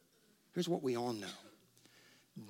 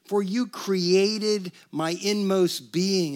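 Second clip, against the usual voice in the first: scripture reading; 4 semitones higher, 6 dB louder, much slower than usual. Acted. Pastor reads verse slowly.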